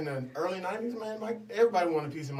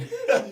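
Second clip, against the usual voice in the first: high burst of laughter; 13 semitones higher, 5 dB louder than usual. Real, not acted. Laughs at her own joke.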